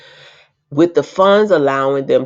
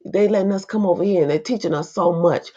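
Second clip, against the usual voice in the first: higher, fast, gushing mock voice; mocking naive voice; 7 semitones higher than usual. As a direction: send up a grateful dupe, quick and high. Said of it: "you got raccoons going over there like".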